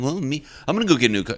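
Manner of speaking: high, fast, loud boast; mock one-upping. Coworker outdoing a rival's news, mock boastful. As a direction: race in to top the other brag. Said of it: "try to, like, one-up each other".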